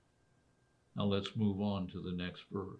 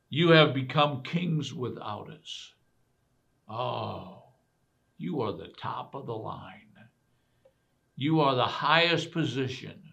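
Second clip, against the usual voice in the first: sarcastic; 2 semitones higher, 6 dB louder than usual. Retold or imitated. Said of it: "And then he goes on".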